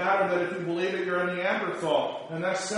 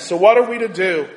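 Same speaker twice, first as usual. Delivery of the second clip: ordinary talk, nothing marked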